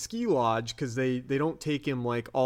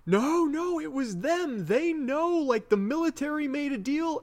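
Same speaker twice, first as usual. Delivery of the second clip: much higher, insistent protest; pleading abductee; 12 semitones higher than usual. Retold or imitated. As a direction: protest desperately that it was them. Duane Barry pleading with Mulder.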